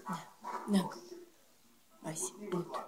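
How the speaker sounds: soft whisper to a pet. Real, not acted. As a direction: whisper the name. (шёпотом)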